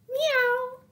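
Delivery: very high mewing meow; cat meow. Acted. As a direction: squeak out a kitten meow. Cat appears in story.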